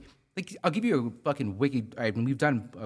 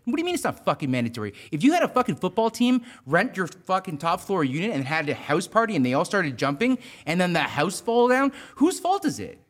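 louder, higher, incredulous rant; exasperated retort; 7 semitones higher, 5 dB louder than usual. Retold or imitated. Contractor retelling how he snapped back at the client, louder and higher. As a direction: fire back at the client in disbelief. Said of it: "I'm like".

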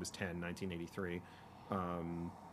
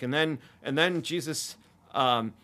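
loud, higher voice, lightly acting a child; imitating kid storyteller; 7 semitones higher, 10 dB louder than usual. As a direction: proclaim it like a small boy inventing a story. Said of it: "And then he's like".

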